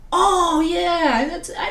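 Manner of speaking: high, loud burst of recognition; mock-student "now I get it" exclamation; dawning recognition. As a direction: imitate a student suddenly remembering.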